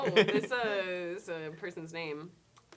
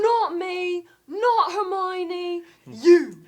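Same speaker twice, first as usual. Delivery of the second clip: loud, higher, slow Ron Weasley line; hammed-up Ron, raised and drawn out; Ron impression; 10 semitones higher, 12 dB louder, much slower than usual. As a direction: Ron insisting it's not him, it's you. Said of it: "that's Ron".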